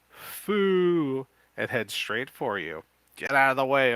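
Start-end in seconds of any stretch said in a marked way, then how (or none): 0.1-1.2 loud, much higher zombie groan "Foo"; zombie moan. 3.2-3.9 louder, higher warning shout.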